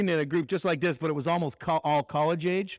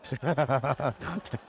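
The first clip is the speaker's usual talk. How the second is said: short real laugh; a quick burst of genuine laughter; 4 semitones lower than usual. Real, not acted. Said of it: "that's when I laughed".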